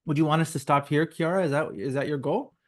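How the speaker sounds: ordinary delivery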